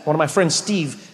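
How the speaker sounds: ordinary talk, nothing marked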